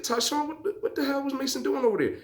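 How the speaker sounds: high, fast mock outrage; exasperated disbelief. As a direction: squeak out the baffled question. Retold baffled question.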